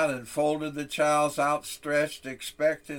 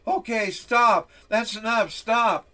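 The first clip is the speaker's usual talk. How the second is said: frightened pleading; 8 semitones higher, 6 dB louder than usual. Acted. Boy begs teacher to stop.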